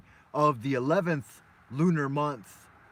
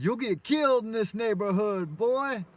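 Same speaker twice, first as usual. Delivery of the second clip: higher, hammed-up street warning; mock gangster warning; 7 semitones higher than usual. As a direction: imitate the gang member scaring the kid off. Gang member warns boy, retold.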